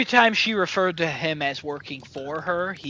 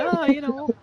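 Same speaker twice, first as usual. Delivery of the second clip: light chatty Irish lilt, a touch higher; breezy Irish actor brushing it off; Irish accent; 7 semitones higher than usual. Retold or imitated. Actor shrugging off his role, in a light Irish accent. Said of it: "just a little Irish guy just talking away".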